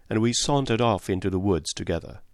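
ordinary delivery

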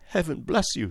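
high-pitched heartfelt thanks; tearful gratitude. Acted. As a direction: bless him as an overwhelmed mother. Grateful mother near tears.

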